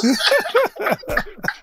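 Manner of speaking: high, cracking laugh at the joke; burst of laughter. Real, not acted. Friend cracks him up, he bursts out laughing. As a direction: break into a squealing laugh.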